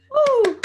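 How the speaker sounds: high, loud joyful whoop; excited whoop. Real, not acted. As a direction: let out a happy whoop. Cheering after finishing the song.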